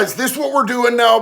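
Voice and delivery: ordinary delivery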